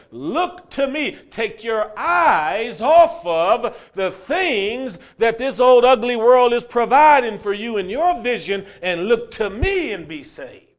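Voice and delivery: slow, loud impassioned plea to look to me; fervent preaching. Acted. Preacher voicing the Lord's call to be saved, loud and fervent. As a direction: drive the call home with fire. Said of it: "this is what the Lord says".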